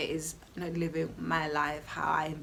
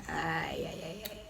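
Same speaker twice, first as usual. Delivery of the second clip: normal talking voice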